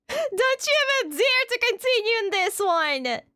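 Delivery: high, whining protest; real whiny plea; whiny wail. Real, not acted. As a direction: wail at chat like you're near tears. Streamer wails at chat.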